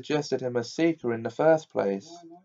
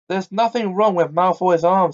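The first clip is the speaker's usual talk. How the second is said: higher and louder, furious outburst; 7 semitones higher, 4 dB louder than usual. A narrator's reading. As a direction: spit out the accusation in anger. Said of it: "said Harry furiously".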